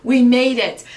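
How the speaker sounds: a playful hooray, we made it; proud parent doing a small mock celebration; excited cheer. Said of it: "You're like".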